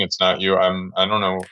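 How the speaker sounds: ordinary talk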